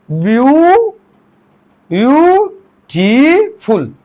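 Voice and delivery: long rising "ब्यू-", then "ब्यूटीफुल" in slow pieces; syllable by syllable. Shown as a demonstration. Teacher showing one vowel per syllable, "ब्यूटीफुल" slow, syllable by syllable. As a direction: sound out "ब्यूटीफुल" as ब्यू, टी, फुल, drawn out. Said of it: "ये, ये हुए ब्यूटीफुल".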